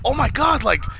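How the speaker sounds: high-pitched imitation of alarmed parents; put-on disbelief, pitched high; mock shock. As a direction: gasp it out as astonished parents.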